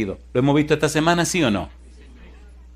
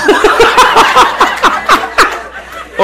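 loud, high burst of laughter; long high cackle; real laughter; 7 dB louder than usual. Real, not acted. Bursts out laughing mid-sermon.

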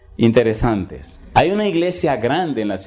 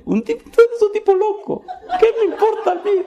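high-pitched, laughing jeer of a hotel worker; mocking employee voice. Employee mocking the unknown preacher, high and laughing. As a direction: play a scornful employee, squeaky and amused.

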